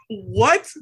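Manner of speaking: high-pitched incredulous question; real disbelief. Real, not acted. Host hearing co-host never saw the show, incredulous. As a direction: let it jump up in astonishment.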